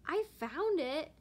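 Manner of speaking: high, slow squeaky insistence; squeaked. Acted. Kitten Dottie squeaking her claim to the egg. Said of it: "Dottie squeaked".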